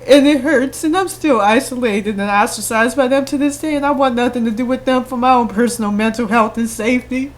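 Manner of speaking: trying not to cry while telling it; real tears breaking through; holding back tears. Real, not acted. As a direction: voice rising and cracking, near tears. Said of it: "I don't mean to cry".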